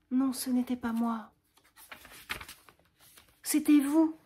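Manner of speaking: high and loud, pressing him; unmasking him with overwhelming emotion; impassioned accusation. Acted. Roxane pressing Cyrano with the truth, high and loud.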